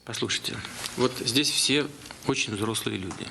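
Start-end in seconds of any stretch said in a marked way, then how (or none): none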